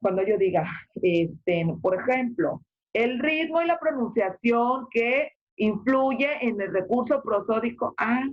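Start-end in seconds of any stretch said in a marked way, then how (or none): 2.9-7.9 raised pitch to flag a key point; emphatic intonation. Shown as a demonstration. Teacher showing how intonation grabs the audience's attention, emphatic raised pitch. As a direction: lift the whole phrase "el ritmo y la pronunciación que influye en el recurso prosódico" higher to grab attention. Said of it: "por la entonación que estoy haciendo".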